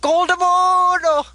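high, slow, stretched-out squeaky name call; chipmunk voice. Retold or imitated. Trying out the villain's name call in a chipmunk voice. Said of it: "It sounded like a chipmunk".

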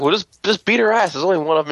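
high, cocky goading; cocky mentor. Retold or imitated. Mentor eggs her on.

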